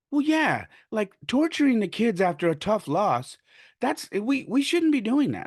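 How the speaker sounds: high-voiced retold objection; mock protesting parent.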